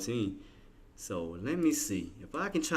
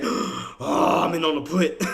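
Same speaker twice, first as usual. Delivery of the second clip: loud, raised-pitch reenacted dismay; retold dismayed realization; 6 semitones higher, 10 dB louder, much slower than usual. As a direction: groan it out as the mistake dawns. Retells discovering the wrong contract.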